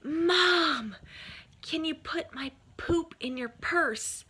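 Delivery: high, loud mimicked kid calling out to Mom; imitated boy's yell. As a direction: holler like a young son across the stands, drawing it out. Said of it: "at the top of his lungs, he yells".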